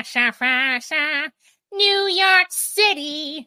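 slow, drawn-out, high announcer flourish; mock showbiz call. Podcast host hamming a celebrity intro, slow and high. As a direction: stretch it out like a diva intro. Playful singsong name call.

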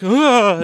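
loud put-on swooning groan; mock fainting groan. Bride faking a faint at the wedding, mock groan. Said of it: "pretends to faint".

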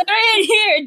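high, loud happy shout; excited shout. Real, not acted. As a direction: yell it with glee. Cheering after the rescue.

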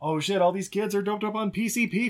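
hammed-up urgent warning; mock panic. Retelling the scene, urging the girl to run in mock panic.

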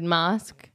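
slow "mosque" for mask; British broad A. Shown as a demonstration. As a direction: draw out "mosque" with an open "ah". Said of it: "she says mosque instead of mask".

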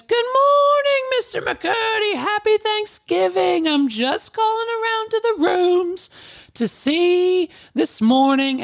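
very high, sing-song falsetto greeting; mock an over-chirpy receptionist, stretching the words; peppy Susan. Receptionist calling rooms on Thanksgiving morning, absurdly peppy. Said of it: "And she's so peppy".

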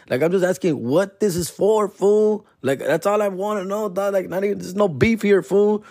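high-pitched insistence on innocence; defensive reassurance. Retold or imitated. Defending himself to offended workers.

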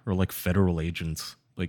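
normal talking voice

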